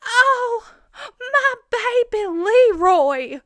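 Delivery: very high, loud, slow cooing; hammed-up high mock mother; doting mother. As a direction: coo over the baby in a shrieking high voice. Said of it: "she's like".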